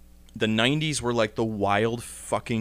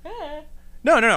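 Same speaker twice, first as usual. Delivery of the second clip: high, light protesting no; playful protest; 3 dB louder than usual. Real, not acted. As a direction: brush it off playfully.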